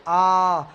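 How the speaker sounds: आ drawn out slowly; teacher demonstrating long आ; long vowel. Shown as a demonstration. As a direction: hold आ long. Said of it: "उसके ठीक दुगुना".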